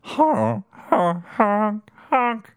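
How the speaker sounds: high silly honk sounds; goose honking. Pretend. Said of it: "He has a voice of a Canadian goose".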